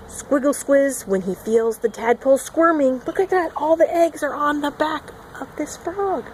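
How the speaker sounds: usual speaking voice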